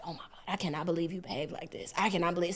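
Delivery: low, very soft scolding; hushed imitation of a mad mother; retold mad mom. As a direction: scold under your breath, embarrassed. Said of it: "So she's just mad".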